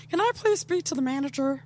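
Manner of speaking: a hint of an entitled Karen. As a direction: demand the manager like a Karen. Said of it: "she's like a Karen".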